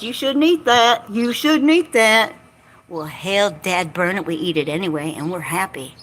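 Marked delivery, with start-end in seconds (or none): 0.0-2.3 higher, louder mock nagging; mock scolding. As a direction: mimic a bossy food warning, drawn out. Mimicking scolding food advice.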